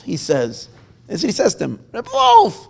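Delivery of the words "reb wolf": calling out. Acted. Old man calls out greeting.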